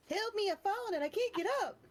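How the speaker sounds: very high teasing squeal; mock helpless cry. Teasing a small companion about falling, mock helpless squeal. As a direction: squeal it like someone tiny and helpless. Mimics the toppled little dwarf, teasing.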